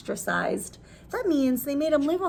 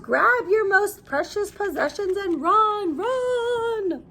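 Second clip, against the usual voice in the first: urgent yell; 6 semitones higher, slower than usual. Acted. Men yell to flee.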